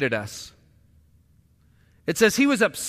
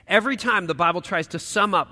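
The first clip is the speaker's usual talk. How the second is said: ordinary delivery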